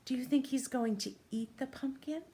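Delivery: ordinary talk, nothing marked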